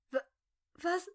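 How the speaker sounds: high, halting gasp of disbelief; frightened stammer. A narrator's reading. Terrified woman facing the gunman, high frightened stammer. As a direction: stammer it out in shocked fear. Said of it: "flüsterte sie mit heiserer Stimme".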